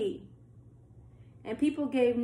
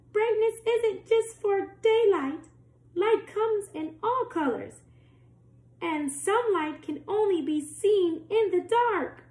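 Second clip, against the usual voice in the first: high, bright star voice, a bit louder; star chiming in; 9 semitones higher, 4 dB louder than usual. A narrator's reading. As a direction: sing it out high like a little star. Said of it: "The star chimed in".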